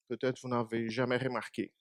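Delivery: ordinary talk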